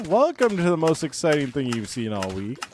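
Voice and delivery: sarcastic hyped-up announcer; mock hype. Mock hype for Minecraft mud.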